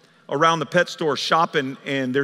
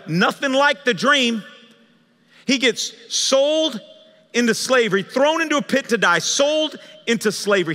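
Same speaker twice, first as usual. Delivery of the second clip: higher-pitched, emphatic sermon voice; impassioned preaching; 6 semitones higher, 4 dB louder than usual. Acted. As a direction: preach it with full conviction. Preaches at full force.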